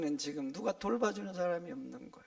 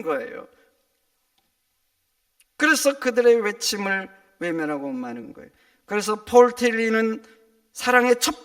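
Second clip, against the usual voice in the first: loud, emphatic sermon delivery; forceful pulpit voice; full-force preaching; 4 semitones higher, 12 dB louder than usual. Acted. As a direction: drive the point home to the congregation.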